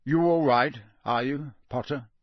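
firm opening, easing off; gruff concern. A narrator's reading. Teacher turns to question another pupil, gruff. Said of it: "much lower and gentler growl".

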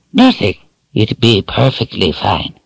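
high raspy crone voice; raspy old woman. A narrator's reading. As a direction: rasp it out like an old witch. Narrator voices Addie.

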